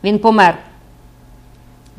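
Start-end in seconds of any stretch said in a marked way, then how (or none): 0.0-0.6 loud, emphatic outburst; exclaiming. A narrator's reading. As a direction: blurt it out loudly. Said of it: "вигукнув священик". (гучний вигук)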